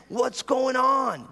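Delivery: high, drawn-out plea to God; re-enacted exasperated prayer, very slow; pleading complaint.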